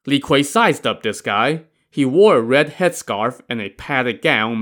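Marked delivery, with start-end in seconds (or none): none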